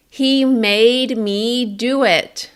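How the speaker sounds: loud, high, every word of "He made me do it" drawn out equally; sustained, even-length words. Shown as a demonstration. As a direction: stretch each sound slowly, breath dropping at the end. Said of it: "I sustained sounds".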